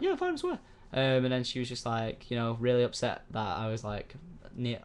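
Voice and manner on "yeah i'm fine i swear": put-on high squeaky kid voice; squeaky reassurance. Boy reassuring his mum, high squeak. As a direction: chirp it like a small boy.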